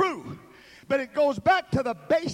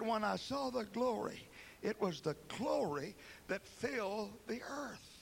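hushed awe; 4 semitones lower, 11 dB quieter than usual. Acted. Hushed retelling of vision.

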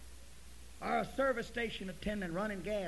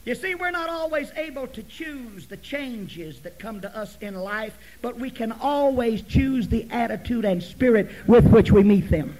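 loud, raised preacher's voice building in force; full-force preaching; 6 semitones higher, 17 dB louder than usual. Real, not acted. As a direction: drive the point home loudly to the congregation.

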